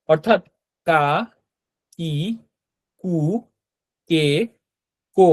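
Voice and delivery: কা, কি, কু, কে, কো said one by one with a pause after each; syllable drill. Shown as a demonstration. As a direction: model each syllable slowly for students. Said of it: "এটা হবে কো". (প্রতিটি অক্ষর আলাদা করে ধীরে উচ্চারণ)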